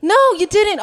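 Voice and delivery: high, loud exasperated inner voice; sassy mock outrage in a high voice; inner rant. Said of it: "A K A".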